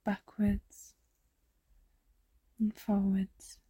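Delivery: soft unvoiced meditation whisper. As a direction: breathe the words out as a whisper. Meditation guide drops to whisper.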